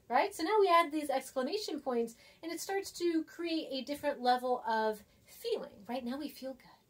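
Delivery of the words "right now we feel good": normal talking voice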